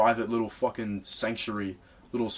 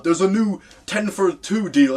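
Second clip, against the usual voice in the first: higher, louder and slow, hamming up a promo notification; mock ad voice; 8 semitones higher, 5 dB louder, slower than usual. Reading Pizza Hut's text aloud to mock it, hammed-up ad voice. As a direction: read it like a cheesy pizza ad jingle. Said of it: "texting them".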